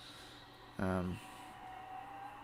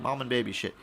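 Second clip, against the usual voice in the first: ordinary talk, nothing marked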